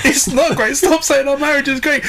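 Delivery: hammed-up domestic quarrel; exasperated wife. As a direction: build into a high-pitched marital protest. Acted marital quarrel, wife leaving.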